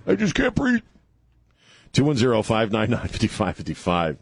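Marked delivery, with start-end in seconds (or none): 0.1-0.8 mock echo. Host echoes caller in mock voice.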